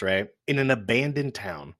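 ordinary talk, nothing marked

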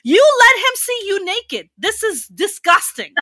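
high-pitched, scandalized outburst; mock disgust. Comic confronting a beautiful wife, mock disgust. Acted disgust at a friend's husband.

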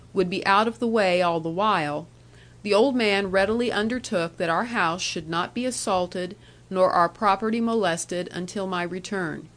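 usual speaking voice

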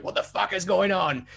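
high-pitched imitation of alarmed housemates; mock bewildered outcry. Big Brother housemates reacting to the zombie attack, mock bewildered. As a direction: pitch it up, imitating baffled confusion. Panicked housemates quoted in retelling.

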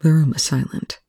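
ordinary delivery, nothing marked

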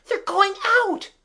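high, loud frightened scream; a girl screaming as the figures walk out; terrified scream. A narrator's reading. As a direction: scream it in horror. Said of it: "screamed Kathleen".

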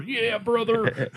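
high, slow wrestling promo growl; wrestler voice. Retold or imitated. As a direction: stretch out brother like a wrestler. Wrestler voice, drawn out.

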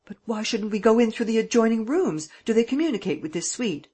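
detective calling out to the hotel staff, urgent and loud; cried out. A narrator's reading. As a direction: call out urgently. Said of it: "Gourel cried".

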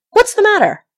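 loud, high-pitched alarmed shout. A narrator's reading. Farmer at the window with his gun, shouting. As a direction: call it out sharply at the top of the voice. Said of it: "leaped to the window and shouted".

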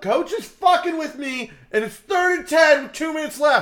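high-pitched mock distress; panicking quarterback. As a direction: whine it urgently to the huddle.

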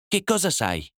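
a hint of the wife's guarded question. A narrator's reading. Wife's silent reply question.

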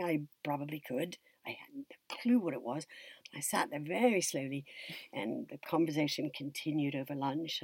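ordinary talk

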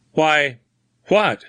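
high, halting bewilderment; startled stammer. A narrator's reading. Newsman alarmed by the stranger's terror, startled stammer. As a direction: break off between the words, unnerved. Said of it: "stammered Grimsby".

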